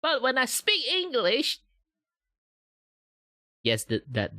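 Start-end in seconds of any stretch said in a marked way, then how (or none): 0.0-1.6 much higher, slow and a bit louder; higher English voice. Shown as a demonstration. Showing viewers the higher English voice. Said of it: "my voice is normally sounds like this".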